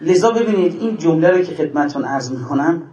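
usual speaking voice

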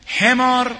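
high, loud outcry of the word حمار; loud cry. Acted. Preacher scornfully cries حمار at the enemy, loud.

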